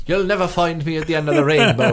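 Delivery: mock Irish leprechaun voice, laughing; hammed-up Irish accent through laughter; Eddie Jordan. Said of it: "I've done an Eddie Jordan impression".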